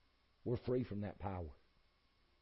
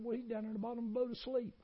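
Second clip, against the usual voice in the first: quick high reply; 13 semitones higher, faster than usual. Retold or imitated. Disciples answering in the storm.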